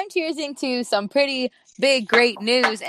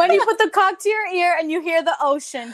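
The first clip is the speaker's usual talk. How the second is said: laughing; 7 semitones higher, faster than usual. Acted. Joking metaphor, laughing.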